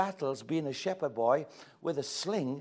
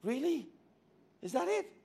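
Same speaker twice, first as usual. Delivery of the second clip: high, let-down "really, is that it"; disbelieving a small calling, pitched up; mock incredulous; 7 semitones higher, 5 dB louder than usual. Believer questioning God about a small task, incredulous.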